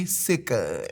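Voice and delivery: siccud said low and drawn, SIK-uh; sounded out. Shown as a demonstration. Spelling out the name to the listener, siccud said SIK-uh. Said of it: "S I C C U D".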